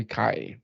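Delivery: Kai with an eye vowel; German name Kai. Shown as a demonstration. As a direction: say Kai, rhyming with sky. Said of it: "it's actually".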